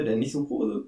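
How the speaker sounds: normal talking voice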